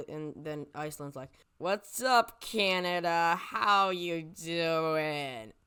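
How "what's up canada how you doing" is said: loud, drawn-out hail; big cocky put-on call-out; mock hearty greeting. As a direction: holler it like a hammed-up arrival, stretching the last words. Iceland voiced hailing Canada.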